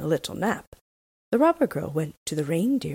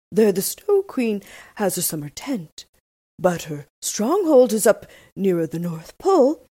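a touch louder, lightly voiced reindeer; proud reindeer; 4 semitones higher, 5 dB louder than usual. A narrator's reading. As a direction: speak fondly of your homeland. Reindeer describing home.

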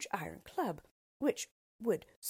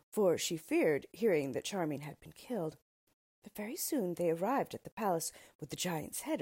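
usual speaking voice

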